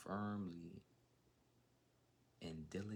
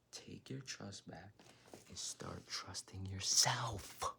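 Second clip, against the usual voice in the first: rising urgent plea; 3 semitones higher, 6 dB louder, slower than usual. Real, not acted. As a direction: push them harder each line to trust themselves. Urging viewer, building.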